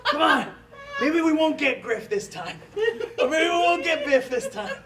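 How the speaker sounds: lightly voiced sad fan, laughing; half-laughing hopeful quip; mock wistful Doc Brown. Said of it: "last time we're going".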